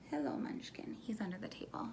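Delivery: soft whisper to the dog. Real, not acted. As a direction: hush it, speaking to a pet. Greeting dog under the table.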